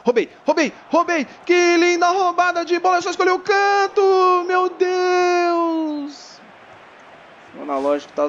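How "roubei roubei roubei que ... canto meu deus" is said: excited yelling. Real, not acted. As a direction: shout it like a big steal. Stealing ball, excited yelling. (gritando empolgado)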